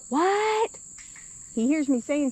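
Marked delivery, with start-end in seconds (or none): 0.1-0.7 high, puzzled "What?" from a half-deaf husband; husband's puzzled what. Retold or imitated. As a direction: mimic a man who can't hear a thing. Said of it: "he's like".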